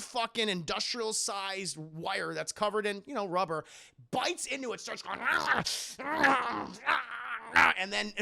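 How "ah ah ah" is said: mock wailing. Actor bites a cable, wailing.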